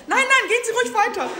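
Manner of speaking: flustered staffer. Retold or imitated. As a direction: hurry the words out like a startled official. Staffer voiced, backing off.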